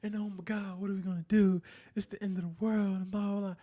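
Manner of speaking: mocking whiny voice. Mocking people offended by Trump in a whiny, high parody. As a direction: mimic offended people melodramatically. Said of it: "Everybody is like".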